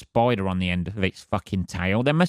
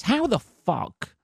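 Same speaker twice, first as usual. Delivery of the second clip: incredulous; 2 semitones higher, slower than usual. Real, not acted. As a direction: stretch the outrage out in a squeak. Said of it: "incredulous outburst".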